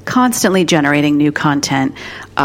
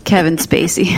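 normal talking voice

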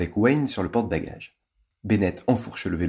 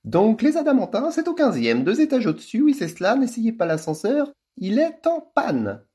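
a touch less affected, still high hostess voice; normal voice resumed; 4 dB louder than usual. A narrator's reading. Ambre giving directions, lightly back to her normal voice. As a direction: drop the act back to normal. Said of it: "reprenant une voix normale".

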